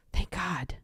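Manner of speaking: hushed, acted thanks under the breath; whispered relief. Mock relief at sandwich offer, repeated.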